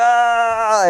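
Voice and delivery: high, drawn-out "aah"; mock cry. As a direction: imitate a helpless paralyzed wail. Imitates a paralyzed man's cry.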